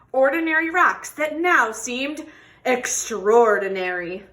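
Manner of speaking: expressive picture-book read-aloud; reading to children. Acted. Teacher reading a tortoise story to children, expressive. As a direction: tell the story warmly to a class.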